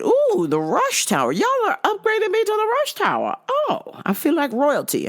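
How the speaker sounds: high, gushing mock delight; mock thrilled. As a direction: squeal like you've won a prize.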